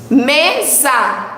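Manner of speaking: "Mesa" as two drawn-out beats, ME, SA; syllable by syllable. Shown as a demonstration. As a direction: sound out both syllables of "Mesa". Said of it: "Quantas sílabas?". ("Mesa" separando as sílabas: me, sa)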